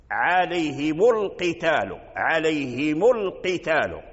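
slow recitation, mu joined into القتال; mim of عليهم voweled with damma. Shown as a demonstration. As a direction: draw out عليهم, linking its mim with u. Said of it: "يحركون الميم". (ضم ميم عليهم عند وصلها بالقتال ببطء)

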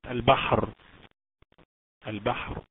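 البحر stopped on a vowelless R straight after h; heavy ra at a stop. Shown as a demonstration. Teacher showing ra when pausing, heavy ra. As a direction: close البحر on a thick ر with no final vowel. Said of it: "راء ساكنة وقبليها حرف الحاء ساكن".

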